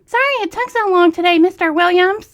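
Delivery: high-pitched voice. Shown as a demonstration. Reader redoing the waitress line in a high flirty voice. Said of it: "my high-pitched voice".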